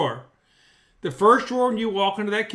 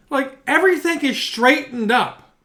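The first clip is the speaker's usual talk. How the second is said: ordinary talk, nothing marked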